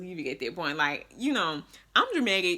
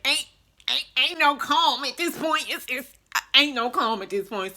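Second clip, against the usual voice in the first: higher, louder re-enacted frantic retort; frantic protest; 7 semitones higher, 5 dB louder than usual. Daughter answering mom who said calm down, frantic protest. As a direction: burst out in mock exasperated panic, stammering.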